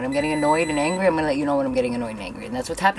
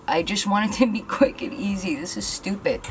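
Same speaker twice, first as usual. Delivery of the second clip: ordinary delivery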